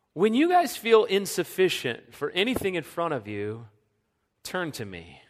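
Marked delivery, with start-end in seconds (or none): 4.4-5.2 gentle call. Acted. Pastor voices Jesus to disciples.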